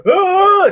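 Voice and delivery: very high, loud startled yelp; a panicked cry as he bolts; scared shriek. Retold or imitated. The attacker fleeing in fright, high panicked shriek.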